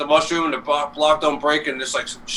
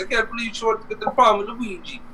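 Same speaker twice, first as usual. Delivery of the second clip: high cartoon self-talk; Luigi; 6 semitones higher than usual. Retelling Luigi at the princess's door, high character voice. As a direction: imitate a cartoon plumber talking to himself, pitched up. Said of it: "he's, like, talking to himself".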